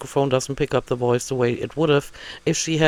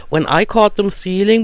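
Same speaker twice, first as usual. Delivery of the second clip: usual speaking voice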